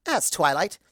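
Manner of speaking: plain reading voice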